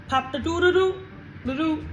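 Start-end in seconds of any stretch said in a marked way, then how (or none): none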